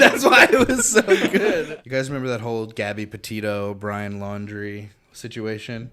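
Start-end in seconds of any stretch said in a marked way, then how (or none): 0.0-1.8 high, loud, slow through laughter; laughing hard. Real, not acted. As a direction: stretch the words out while cracking up. Defends the joke while laughing.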